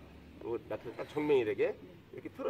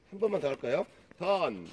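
higher, louder drill call; barking gym coach; 4 semitones higher, 7 dB louder than usual. Shown as a demonstration. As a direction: bark the turn command.

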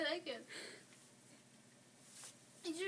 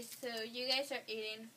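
normal talking voice

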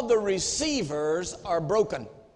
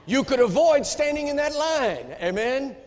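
punchline; 7 semitones higher, 5 dB louder than usual. Real, not acted. Punchline to congregation.

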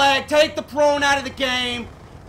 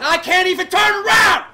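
higher, louder angry yell; frustrated shout; 5 semitones higher, 5 dB louder than usual. Real, not acted. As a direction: yell it out in gamer rage. Same line, then shouted.

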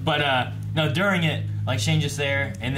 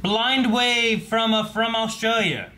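hesitant read; 7 semitones higher, much slower than usual. Real, not acted. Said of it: "It's like he hesitated".

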